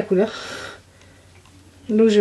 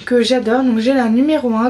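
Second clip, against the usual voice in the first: ordinary delivery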